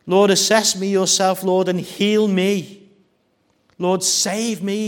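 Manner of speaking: loud, high, slow plea to God; crying out. Acted. Jeremiah crying out to God, a loud, high, slow plea. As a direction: cry out to the Lord for rescue.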